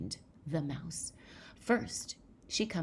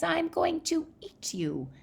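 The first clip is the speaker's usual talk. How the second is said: put-on high, loud child-monster threat; mock menace; 13 semitones higher, 6 dB louder than usual. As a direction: play-act a little monster about to pounce. Child threatens the tiny mouse.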